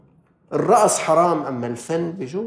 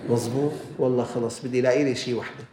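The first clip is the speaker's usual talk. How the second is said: muttering to himself; 4 semitones lower, 5 dB quieter than usual. Retold or imitated. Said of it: "بيقول".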